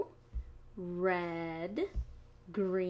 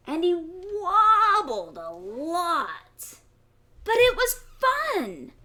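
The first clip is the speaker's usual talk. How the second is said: animated read-aloud, louder; story narration; 4 semitones higher, 8 dB louder, slower than usual. A narrator's reading. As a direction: read it big and playful for kids.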